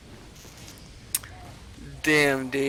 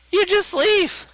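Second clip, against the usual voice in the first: just leave; 4 dB louder than usual. Real, not acted. Easy fix, just quit the game.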